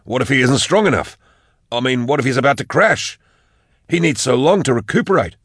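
nervous, mounting concern; anxious. A narrator's reading. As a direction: fret with rising pitch. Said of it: "said Da Vinci anxiously".

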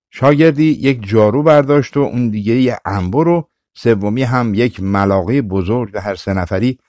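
ordinary delivery

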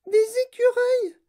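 put-on very high, loud little-girl squeal; Veruca Salt's cry. A narrator's reading. Spoilt girl spotting the squirrels, crying out. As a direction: shriek it out. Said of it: "cria Veruca Salt".

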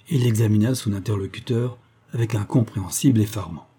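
plain reading voice

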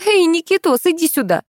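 high-pitched call out of a window; acted shout, high but not full force. A narrator's reading. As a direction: call a child over in a high voice. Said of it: "вывесилась из окна и закричала".

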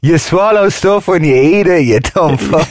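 mock scornful retort. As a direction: sneer at the know-it-all mate.

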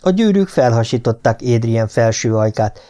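usual speaking voice

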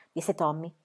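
ordinary delivery, nothing marked

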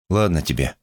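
ordinary delivery, nothing marked

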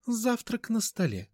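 high, slow, mannered announcement; a grand dame drawing it out; high affected voice. A narrator's reading. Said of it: "высокий манерный голос". (высокий манерный голос)

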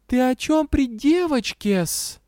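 high, slow indignant kitten voice; kitten scolding. A narrator's reading. Kitten rebuking the dragon, scolding. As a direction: scold as a tiny hissy kitten. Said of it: "прошипела царапка и добавила".

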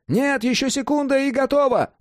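very high, urgent refusal; excited protest. A narrator's reading. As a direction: plead for a moment in a high, eager voice.

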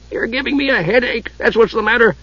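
high, pained grumble; aggravated dwarf. A narrator's reading. Dwarf blames the Kender for his headache, aggravated. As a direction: complain as if the head aches.

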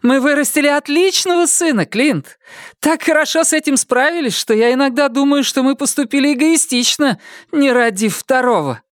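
high, lively voice of a giddy wife; excited wife voice. Acted. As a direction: speak as an overexcited, overtired wife. Lila voiced by narrator.

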